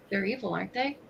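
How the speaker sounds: ordinary talk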